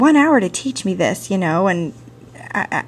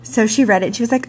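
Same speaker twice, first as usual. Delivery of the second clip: ordinary delivery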